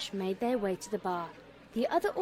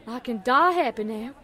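excited; 3 semitones higher, 9 dB louder than usual. A narrator's reading. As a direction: gush like an overjoyed fan. Excited fan before the show.

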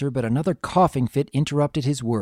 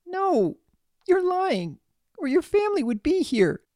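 put-on very high child's denial; tearful denial. A narrator's reading. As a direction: refuse to believe it, near tears. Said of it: "could feel tears welling up".

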